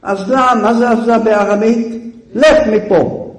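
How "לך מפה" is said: Joseph ordering. Acted. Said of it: "אומר לו יוסף".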